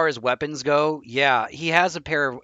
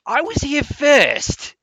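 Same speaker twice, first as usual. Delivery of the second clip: higher, louder, slow insistence; indignant; 12 semitones higher, 6 dB louder, much slower than usual. Acted. Player character arguing with his double, indignant. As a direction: stake your claim hard. Quarrel between twins.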